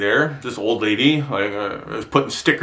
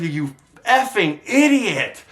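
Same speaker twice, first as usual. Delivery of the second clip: angry insult; 6 semitones higher, slower than usual. Retold or imitated. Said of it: "I said".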